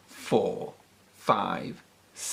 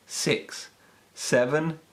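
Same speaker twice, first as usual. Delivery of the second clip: ordinary delivery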